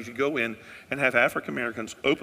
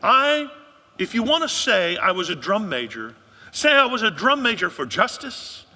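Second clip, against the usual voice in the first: loud, high oratory in King's cadence; preaching King quote; 8 semitones higher, 8 dB louder than usual. Retold or imitated. Pastor quoting King to the church, loud high oratory. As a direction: ring it out like a civil rights sermon. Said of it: "Martin Luther King Junior said".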